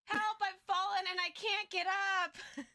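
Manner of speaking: high put-on cry for help, hammed-up; a fallen villain whining, played for laughs; mock helpless plea. Cohost voicing crushed Atraxa, mock helpless cry.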